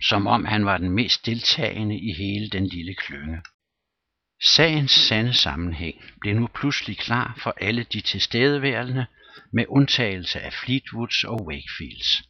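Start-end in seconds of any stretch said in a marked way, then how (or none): none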